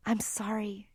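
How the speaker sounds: soft, hushed apology; whispered. A narrator's reading. Laurel apologising as she leaves David, softly whispered. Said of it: "she whispered".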